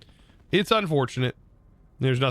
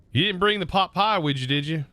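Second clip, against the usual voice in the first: Southern tag question, pitched up and playful; Southern accent, higher; 7 semitones higher than usual. Shown as a demonstration. Streamer repeating the Southern phrase for chat, higher Southern accent. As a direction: play up the Southern would you, did you. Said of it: "The accent is strong with me today".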